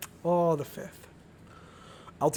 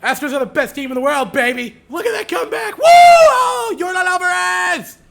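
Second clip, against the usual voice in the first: much higher, louder fan cheering; excited shouting; 13 semitones higher, 12 dB louder than usual. Real, not acted. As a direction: yell it like a wild fan. Breaks the calm with a cheer.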